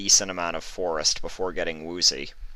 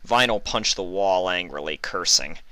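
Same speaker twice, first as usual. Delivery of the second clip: ordinary delivery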